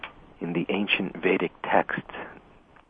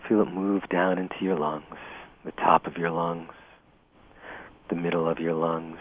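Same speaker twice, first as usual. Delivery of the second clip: guided meditation. Acted. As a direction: ease the listener into calm, unhurried. Leads a guided breathing meditation.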